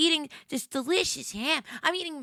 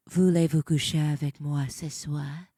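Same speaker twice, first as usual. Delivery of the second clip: low French line; 10 semitones lower than usual. Shown as a demonstration. Streamer shows off French to chat, dropping the voice low. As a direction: drop the voice low and sultry for "Voulez-vous coucher avec moi ce soir?". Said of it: "I can say".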